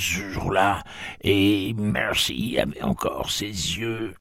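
put-on high, slow old woman reminiscing; an ancient aunt recalling her birthday. A narrator's reading. Aunt Teresa answers Jake, high and slow.

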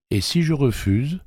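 controlled defiance, rising pitch; coldly. A narrator's reading. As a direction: ask it as a cold dare. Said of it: "demanda-t-il froidement".

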